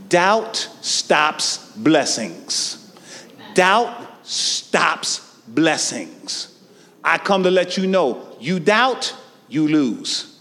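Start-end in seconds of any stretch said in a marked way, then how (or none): 0.1-2.8 very slow, low, each word weighted; slow, weighty. Shown as a demonstration. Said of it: "repeated slowly". 3.5-6.5 very slow, low, each word weighted; slow, weighty. Shown as a demonstration. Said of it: "repeated slowly".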